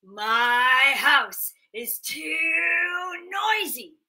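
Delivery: loud slow despairing cry; hammed-up old man crying; wailing old man. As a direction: wail it out in frustration. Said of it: "the old man would say".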